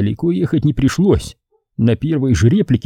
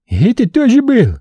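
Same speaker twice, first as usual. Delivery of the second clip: уверенно воскликнул; 12 semitones higher, 4 dB louder than usual. Acted. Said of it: "уверенно воскликнул Умар".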